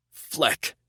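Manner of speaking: high snapped curse. A narrator's reading. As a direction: spit the curse out in alarm. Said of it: "Sachs cursed".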